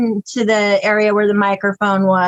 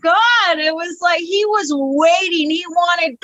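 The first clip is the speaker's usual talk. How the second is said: laughter fading; 8 semitones higher than usual. Real, not acted. Still laughing after the story.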